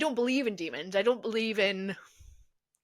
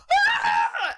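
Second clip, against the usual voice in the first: high, loud mock scream; retold startled scream; 5 dB louder than usual. Acts out screaming when boss jumped out, high startled shriek. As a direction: let out a shriek, re-enacting a jump scare. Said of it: "I screamed and threw".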